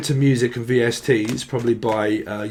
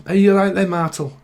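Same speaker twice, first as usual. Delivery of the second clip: imitating grandma's northern call; lightly mimicked northern grandma; grandma's northern accent; 5 semitones higher than usual. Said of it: "she used to call him Martel".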